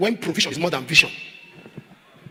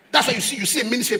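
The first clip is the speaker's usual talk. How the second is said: ordinary delivery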